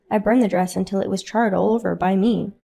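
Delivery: ordinary talk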